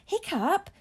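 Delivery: high, rising, wondering question; marvelling, lifted at the end; murmur of wonder. A narrator's reading. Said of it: "murmur of wonder from the crowd as they whispered".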